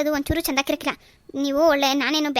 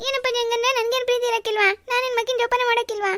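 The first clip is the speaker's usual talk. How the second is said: much higher, crying plea; tearful protest; 9 semitones higher than usual. Acted. Daughter pleads to her father through tears, higher and crying. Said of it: "ಕಣ್ಣೀರು ಒರ್ಸು".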